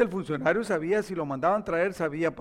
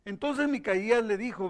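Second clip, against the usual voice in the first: ordinary talk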